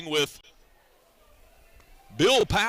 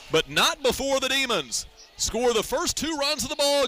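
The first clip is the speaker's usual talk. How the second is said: high, lifted summary call; announcer closing out the inning; excited wrap-up; 8 semitones higher than usual. Real, not acted. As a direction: sell the two runs.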